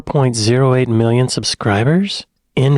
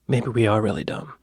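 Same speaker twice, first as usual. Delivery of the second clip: ordinary talk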